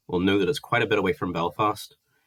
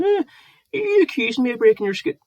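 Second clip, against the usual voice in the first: high, mocking whine, much higher and louder; mocking whiny voice; 5 dB louder than usual. [name] mimics Gareth's hurt accusation in a high mock whine. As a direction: squeak it out like a sulking grown man.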